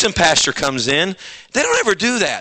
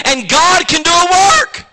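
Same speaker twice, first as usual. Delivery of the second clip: voice changes; 8 semitones higher, 3 dB louder than usual. Real, not acted. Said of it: "See, my voice changes".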